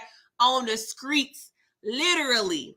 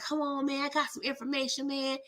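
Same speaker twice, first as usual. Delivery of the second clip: hushed plea; 3 semitones higher, 9 dB quieter than usual. Retold or imitated. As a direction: quietly push the info fast, like a snitch. Sam pleading from the cell.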